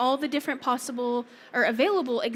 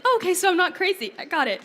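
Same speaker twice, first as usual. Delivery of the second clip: relieved excitement; 7 semitones higher, 5 dB louder, faster than usual. Retold or imitated. Relieved student after AI admits error.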